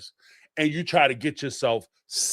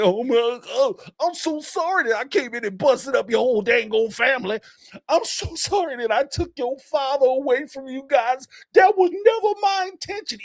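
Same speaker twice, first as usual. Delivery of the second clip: higher, hammed-up fake tearful apology; mocking tearful apology; 10 semitones higher than usual. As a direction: play a fake weepy apologizer. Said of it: "tear in my eye and my voice is a little shaky".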